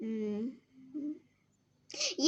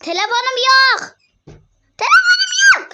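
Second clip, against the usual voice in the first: panicked; 11 semitones higher, 12 dB louder than usual. Real, not acted. Panic over missing phone.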